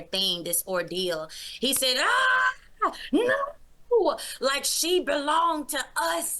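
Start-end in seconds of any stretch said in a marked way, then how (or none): 2.0-4.2 high, loud anguished "Ah, no!"; eerie demon cry. Retold or imitated. Beelzebub crying out as he loses the soul, high and loud. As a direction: wail like a thwarted demon. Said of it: "the craziest, eeriest voice ever".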